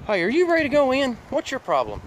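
fast and high, calling a pet. Real, not acted. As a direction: sing it out high to the dog.

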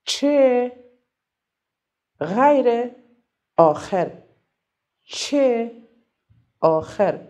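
each word alone with long pauses; slow dictation. Shown as a demonstration. Teacher leading pupils in tracing letters, slow spaced-out naming. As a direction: draw out each name slowly while tracing it.

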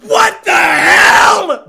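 high, drawn-out scream; shocked shout. Real, not acted. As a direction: yell it very slowly, way up high. Streamer shocked in the lobby.

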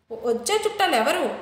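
ordinary delivery

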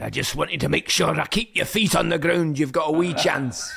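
gruff Scottish manager, firm but fatherly; a Scottish boss keeping the lad grounded; Scottish accent, stern then kind. Retold or imitated. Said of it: "a brilliant accent as well".

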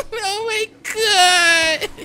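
high, drawn-out fake crying; mock sobbing wail. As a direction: wail slowly in hammed-up despair. Mock wailing after game death.

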